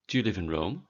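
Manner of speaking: ordinary delivery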